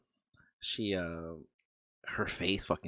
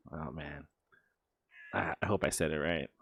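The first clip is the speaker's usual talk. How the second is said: normal talking voice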